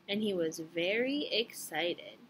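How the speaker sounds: plain reading voice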